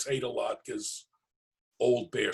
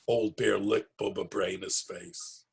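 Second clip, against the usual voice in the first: plain reading voice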